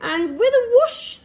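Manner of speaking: high, loud burst on whoosh; whooshing takeoff. A narrator's reading. Narrator reading to children, rocket zooming off, big whoosh. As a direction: launch the word like a rocket.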